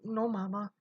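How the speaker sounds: murmured. A narrator's reading. Son answering his angry mother, soft murmur. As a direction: barely get it out, defeated. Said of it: "she murmured".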